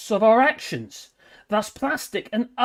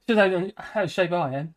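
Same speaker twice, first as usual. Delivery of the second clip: laughing; 3 dB quieter than usual. Real, not acted. Laughs at own unfitness.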